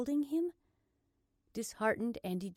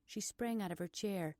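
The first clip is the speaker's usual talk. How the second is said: plain reading voice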